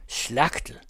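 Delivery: understated mock shout; lightly called-out jeer; shouted insult. A narrator's reading. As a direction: toss it out as an insult. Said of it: "råber så igen, ligesom et skældsråb".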